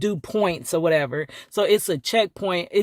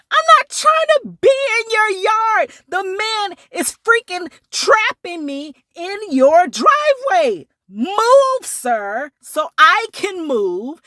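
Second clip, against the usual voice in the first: high, loud, indignant plea; exasperated protest; 13 semitones higher, 5 dB louder than usual. Retold or imitated. To the owners, high loud exasperated protest. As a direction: protest loudly, pitched way up.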